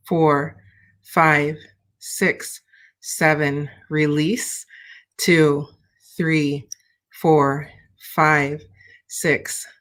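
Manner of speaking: slow, calm counting through the breaths; calm breathing-exercise countdown; guided breathing count. Acted.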